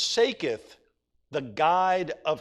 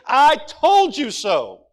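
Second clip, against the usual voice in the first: wisdom rebuking; 7 semitones higher, 10 dB louder, slower than usual. Acted. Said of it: "And wisdom is saying".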